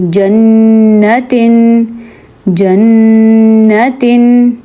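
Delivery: جنتن very slow, the ن held through the nose; nasal ghunna. Shown as a demonstration. Teacher shows ghunna on جنتن. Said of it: "ناک میں آواز نکلے گی".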